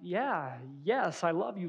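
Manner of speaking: soft, mildly uneasy reassurance; a little nervous. Acted. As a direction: answer quietly, slightly unsure of yourself. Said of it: "a little nervous in your reply".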